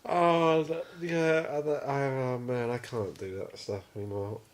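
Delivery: normal talking voice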